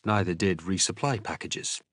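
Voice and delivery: usual speaking voice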